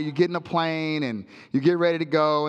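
usual speaking voice

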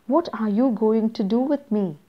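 ordinary talk

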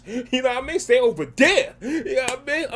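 laughing through the words at the ad; amused, laughing warning; laughing tease. Real, not acted. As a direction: chuckle it out, playfully wary. Joking at the Bloody Mary ad.

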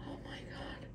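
breathless whispered awe; hushed real whisper; awed whisper. Real, not acted. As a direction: whisper it, stunned by the nest.

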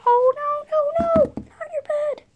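very high wail, fading away; high, panicky pleading; alarmed protest. Acted. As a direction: cry out in dismay, trailing into the distance. Alarm over the bed.

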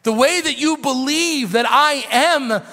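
fiery revival preaching; preaching at full force. Acted. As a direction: build to a shouting crescendo.

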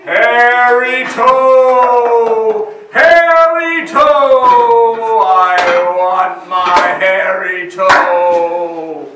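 slow, loud monster wail; a drawn-out booming ghostly cry; hollow yelling voice. A narrator's reading. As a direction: yell it at the cottage door. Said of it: "a hollow voice yelled".

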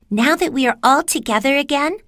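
put-on high girl's voice; rally the friends as Dorothy, high; young Dorothy. A narrator's reading.

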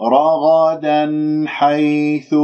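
very slow, sustained recitation; demonstrated tajweed recitation. Shown as a demonstration. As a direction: stretch each long vowel and hum the noon. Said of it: "إذا أستمر". (ترتيل تعليمي بطيء)

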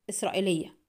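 prompt, quiet reply with falling end; quick answer. A narrator's reading. Customer answering the forger's question, promptly. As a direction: answer at once, softly closed off. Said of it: "أتاه الجواب في سرعة".

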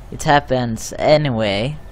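ordinary talk

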